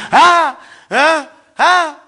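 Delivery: high, loud, confused "ah, ah"; a lost soul stammering in panic; helpless stammer. Acted. Dead man stammering before the two angels, high and loud. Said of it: "And he's".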